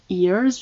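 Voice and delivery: "ears" with a long ee and sounded ending; "ears" drawn out as a model; every letter pronounced. Shown as a demonstration. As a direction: say "ears" fully, no silent letters. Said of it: "on prononce le E, le A, le R et le S".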